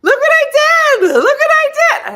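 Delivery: hammed-up thrilled husband showing off; real happy. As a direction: crow it proudly, way up high and loud. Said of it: "real happy".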